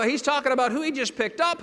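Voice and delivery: ordinary delivery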